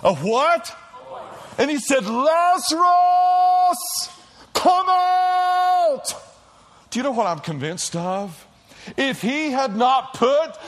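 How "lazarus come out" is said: loud, high, drawn-out call; Jesus calling Lazarus from the grave, loud cry. Acted. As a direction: call the dead man out of the tomb. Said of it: "he cried out with a loud".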